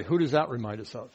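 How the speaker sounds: normal talking voice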